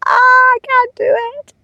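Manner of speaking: high-pitched mock wail; mock panicked brain. Her brain balking at recording, mock panic. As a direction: play the brain giving up in a high squeal. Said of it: "my brain is like".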